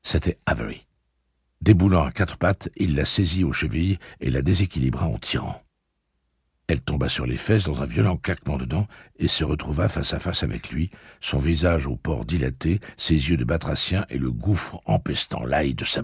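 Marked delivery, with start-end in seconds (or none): none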